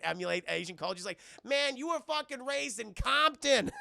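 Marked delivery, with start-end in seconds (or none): 1.4-3.7 high, loud, drawn-out disbelief; incredulous high-pitched teasing; mock ridicule. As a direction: imitate someone roasting a pretender. Retold Tucker tearing into Cheadle.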